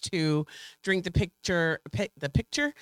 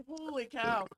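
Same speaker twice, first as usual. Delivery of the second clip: winded after chugging; breathless after a cold chug; in ice cold water; 5 semitones higher, 8 dB quieter, much slower than usual. Real, not acted. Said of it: "And my eyes are watering".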